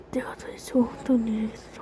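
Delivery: soft, low whisper. Acted. Confused character whispering to himself. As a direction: whisper it to yourself, dazed.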